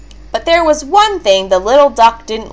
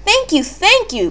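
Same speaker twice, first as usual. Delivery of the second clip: high, lightly voiced duck; thankful duck in a high voice; quacked thanks; 10 semitones higher, 3 dB quieter than usual. A narrator's reading. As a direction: pitch it up like a grateful duckling. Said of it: "quacked the duck".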